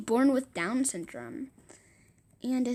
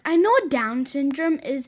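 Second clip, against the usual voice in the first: louder defensive reply; loud insistent retort; 2 semitones higher, 8 dB louder than usual. Retold or imitated. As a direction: shoot back loudly, offended. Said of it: "And they were like".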